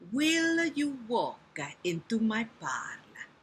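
slow Italian-accented spider. Shown as a demonstration. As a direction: schmooze in a drawn-out Italian accent. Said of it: "I can make him Italian".

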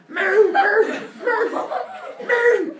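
loud, high, drawn-out comic moos; cow mooing in distress. Acted. Sacrificed cow protesting to baby, frantic mooing. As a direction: bellow like a panicked cow, slowly. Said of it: "And the cow's like".

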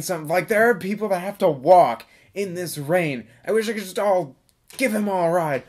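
ordinary talk, nothing marked

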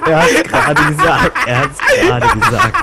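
high and loud through laughter; laughing. Real, not acted. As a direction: squeal it out laughing. Laughing at the joke.

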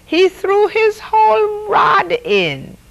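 high-pitched, whooping announcement; gleeful high hoot. A narrator's reading. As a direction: hoot it way up high, amazed at the blunder. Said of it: "Desmond hooted".

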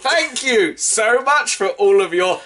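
shouted, high, slowing mock gratitude; loud, high comic villain voice; hammed-up villain. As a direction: bellow it like a cartoon conspirator. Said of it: "I was hamming it up for comedic effect".

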